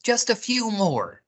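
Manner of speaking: high little rabbit voice; high-pitched coaxing; insistent rabbit. A narrator's reading. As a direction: coax friends as a storybook rabbit. Rabbit pleads in the story.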